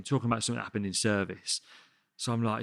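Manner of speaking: normal talking voice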